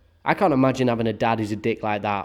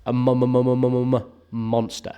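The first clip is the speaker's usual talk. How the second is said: mock stutter. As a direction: stammer out "monster" on one level pitch. Mock stutter on the word monster.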